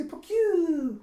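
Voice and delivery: high, loud, drawn-out animal cry; imitated pet squeak. Pet squeaking at the party, long high cry. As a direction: squeal like a small critter.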